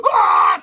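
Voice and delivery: loud low panicked scream. A narrator's reading. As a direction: scream and flee. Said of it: "screamed Melvin".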